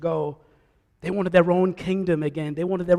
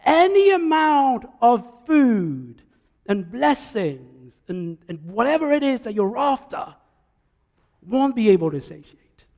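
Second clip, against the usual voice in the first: voice of God calling; 5 semitones higher than usual. Acted. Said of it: "And the voice says".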